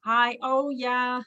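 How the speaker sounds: ordinary delivery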